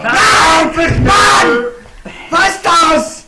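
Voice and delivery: loud high frustrated scream. Real, not acted. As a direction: scream into the mic. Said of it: "Auf zu schreien, ey! Du übersteuerst voll!".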